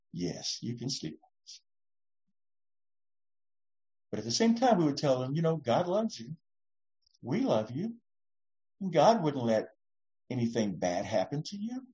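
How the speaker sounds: soft, gentle reassurance; a parent comforting a scared child; tender parent. Pretend.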